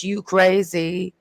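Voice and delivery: normal talking voice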